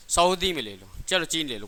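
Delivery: ordinary talk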